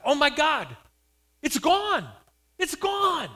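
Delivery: high, very loud astonished cry. Retold or imitated. Mother crying out that the tumor is gone, astonished shout. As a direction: shout it out, pitch soaring. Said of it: "cries out".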